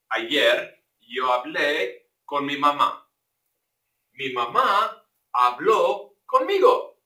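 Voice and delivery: slow, clear Spanish sentences; slow model sentences. Shown as a demonstration. Teacher reads Spanish example sentences slowly for the class. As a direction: read examples carefully for learners.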